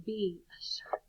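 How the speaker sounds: whispered commands; hushed game of whispered instructions. Shown as a demonstration. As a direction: whisper each action softly. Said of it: "in a whisper voice".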